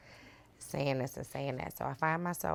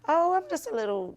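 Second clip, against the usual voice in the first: gentle excuse; 12 semitones higher, 5 dB louder than usual. Retold or imitated. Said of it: "sometimes I just be like".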